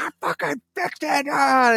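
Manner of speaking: high, squeezed victory yell, held back in volume; re-enacted triumphant scream. Son telling dad he fixed it, triumphant screaming. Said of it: "And I'm screaming".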